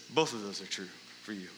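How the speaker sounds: almost whispered, tender; hushed Jesus voice. Pretend.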